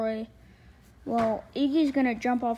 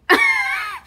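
very high, loud burst of laughter; shrill laugh; 6 dB louder than usual. Shown as a demonstration. Showing Roy why it's funny, shrill burst of laughter. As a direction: show what a laugh sounds like. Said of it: "see, watch".

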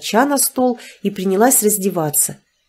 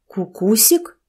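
puzzled rising question; can't believe the pet name; surprised; slower than usual. A narrator's reading. Tamara hearing the husband's pet name, surprised. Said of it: "удивилась я".

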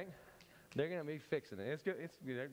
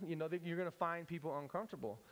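ordinary talk

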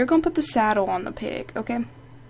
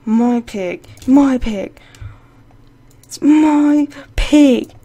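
angry shout; 2 semitones higher, 7 dB louder, much slower than usual. Real, not acted. Gamer yelling at the player who took her pig, angry. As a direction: draw it out in outrage.

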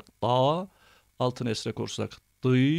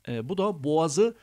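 ordinary delivery

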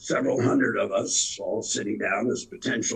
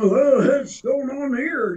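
bewildered newcomer; 14 semitones higher, 6 dB louder, slower than usual. Retold or imitated. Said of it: "they go out there and go".

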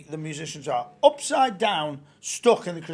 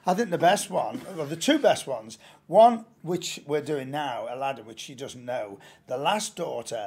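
ordinary talk, nothing marked

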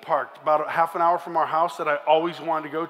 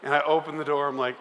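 ordinary talk, nothing marked

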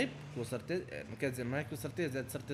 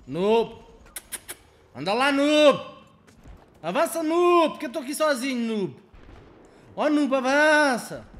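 exasperated yelling; 8 semitones higher, 8 dB louder than usual. Real, not acted. Yelling at lagging teammate.

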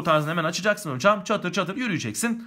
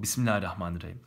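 normal talking voice

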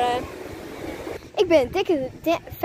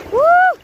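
cold shock yelp; 12 semitones higher, 8 dB louder than usual. Real, not acted. Stepping into ice-cold water.

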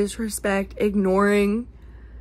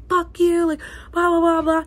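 mock fight; 8 semitones higher, 4 dB louder than usual. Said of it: "Normal people could be like".

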